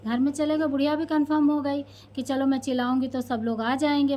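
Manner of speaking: ordinary talk, nothing marked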